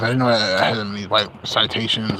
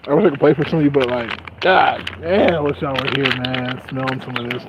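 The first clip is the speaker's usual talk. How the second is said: ordinary talk, nothing marked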